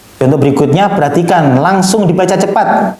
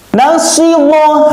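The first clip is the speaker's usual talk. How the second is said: high, drawn-out chanting of the syllables; Quran recitation; 13 semitones higher, much slower than usual. Shown as a demonstration. Teacher recites for pupils to copy, high and drawn out. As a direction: chant it like a tajwid teacher showing the sound. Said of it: "Harus tekan gitu".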